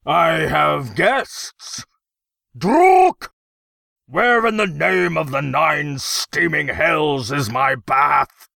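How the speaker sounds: loud, high, blustering royal outrage; angry emperor. A narrator's reading. Emperor demanding his bath from a servant, angry bellow. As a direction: bellow like an affronted tyrant.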